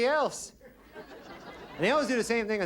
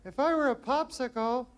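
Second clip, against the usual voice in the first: put-on high, breathy-free girly voice, much higher; girly contestant voice; 8 semitones higher than usual. Contestant asking the bachelor a suggestive question, high girly voice. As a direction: play the coy contestant reading the card. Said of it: "Girl gotta go".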